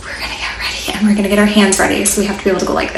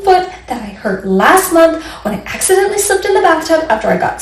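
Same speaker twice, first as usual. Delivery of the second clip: higher, fast rambling complaint; upset little boy; 7 semitones higher than usual. Acted. Boy Sam telling the monster why he cries. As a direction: pour it all out like a wronged kid. Crying boy's tearful rant.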